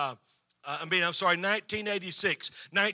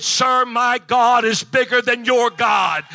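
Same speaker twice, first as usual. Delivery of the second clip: slow, loud, proud declaration; triumphant retort; 6 semitones higher, 14 dB louder, slower than usual. Retold or imitated. Pastor telling the inspector off, slow triumphant shout. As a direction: throw it back at him in triumph.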